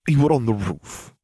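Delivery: dazed, groaned question; groaning. A narrator's reading. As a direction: mildly groan it out, just coming round. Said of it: "he groaned".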